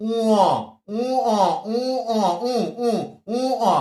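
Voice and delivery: উ, অ as a long nasal "uu", then a separate open "o"; letter-name demonstration. Shown as a demonstration. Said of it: "এই বর্ণটার উচ্চারণ কিন্তু বলতো".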